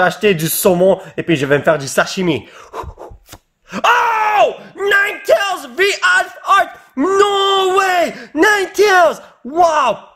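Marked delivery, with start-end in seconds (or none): 3.7-10.1 thrilled shout. Real, not acted. Pulls a rare Alpha Art card.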